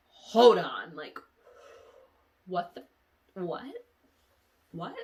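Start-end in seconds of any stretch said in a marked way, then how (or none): 0.1-1.0 loud, sudden hold on; startled. Acted. Acting out her confusion on waking. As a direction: stop short in alarm. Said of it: "startled on waking".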